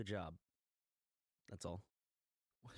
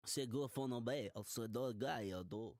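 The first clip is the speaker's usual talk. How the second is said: mock French gibberish. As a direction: fake French drifting into gibberish. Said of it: "as you trying to speak French ... some South African gibberish ... speaking Japanese".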